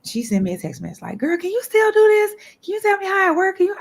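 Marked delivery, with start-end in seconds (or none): 1.2-3.8 high, loud plea from a friend; high-pitched, punchy girlfriend question; friend's texted request. Retold or imitated. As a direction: voice a pal asking a favor, pitched way up. Voicing friend's eager text message.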